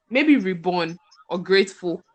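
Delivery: ordinary talk, nothing marked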